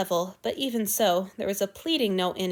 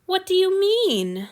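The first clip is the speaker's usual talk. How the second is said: anxious; 10 semitones higher, 5 dB louder than usual. A narrator's reading. Antony confused and anxious.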